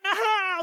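high, drawn-out ow; hammed-up pained cry; Daddy Bear's howl. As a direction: howl with a burnt tongue. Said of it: "says Daddy Bear".

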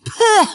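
« peuh » aigu, comme craché; recrachement dégoûté. A narrator's reading. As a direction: recrache d'un coup, dégoûté. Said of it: "cracha-t-il". (petit crachat de dégoût, haut perché)

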